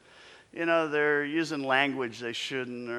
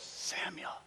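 whispered call; 11 dB quieter than usual. Acted. God calling, fourth time.

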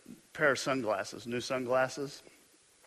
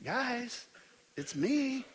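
gentle imagined Jesus; 8 semitones higher than usual. Retold or imitated. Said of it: "expect Jesus to say something like".

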